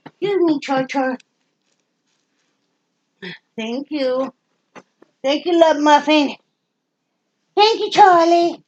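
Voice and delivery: high sweet voice to the dog; pet talk. Real, not acted. Woman thanking her dog Charlie in a high doting coo.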